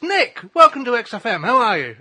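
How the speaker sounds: loud, high, upbeat radio DJ welcome; cheery DJ greeting. Retold or imitated. DJ welcoming [name] live on air, loud and bright. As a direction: greet the guest like a bubbly presenter.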